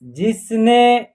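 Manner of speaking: जिसने drawn out slowly; जिसने sounded out slowly; slow word blending. Shown as a demonstration. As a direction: model जिसने slowly for learners. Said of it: "न में ए की मात्रा ने".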